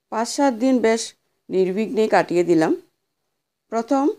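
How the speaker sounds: ordinary delivery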